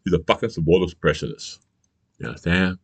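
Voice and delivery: ordinary talk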